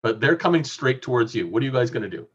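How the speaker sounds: normal talking voice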